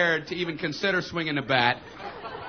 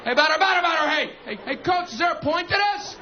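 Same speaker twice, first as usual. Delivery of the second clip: high mock fielder's shout; high-pitched, exasperated yell in play-acting; shouted chatter; 10 semitones higher than usual. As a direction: chant it, then holler a complaint to the coach. Fielder chanting, then protesting to coach.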